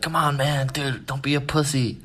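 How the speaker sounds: high-pitched imitation of a pushy friend; goading. Friend urging him to go upstairs, retold goading. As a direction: egg on a reluctant pal. Friend goads the reluctant teller.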